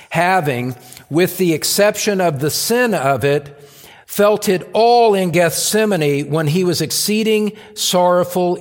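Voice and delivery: high, loud pulpit reading; declaimed quotation. Acted. Preacher quotes Spurgeon's consolation in Christ to the church, declaimed with force. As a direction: proclaim it with force, easing down at the end.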